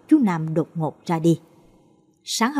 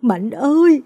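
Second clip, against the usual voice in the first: loud, higher grieving cry; mother wails her son's name at the tree; anguished wail; 6 semitones higher, 6 dB louder, slower than usual. Acted. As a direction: call out to the dead son in agony. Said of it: "gào lên đau đớn".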